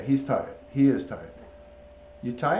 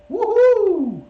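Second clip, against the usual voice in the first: loud, very high whoop; 12 dB louder than usual. Shown as a demonstration. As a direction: whoop it out to rouse a pet. Whooping to rouse the pet bird.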